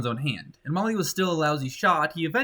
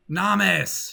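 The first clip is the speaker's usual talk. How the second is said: mild triumphant yell; 6 semitones higher, 5 dB louder than usual. A narrator's reading. Girl with speared trout, a mild triumphant yell. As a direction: call it out, higher and louder. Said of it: "she yelled".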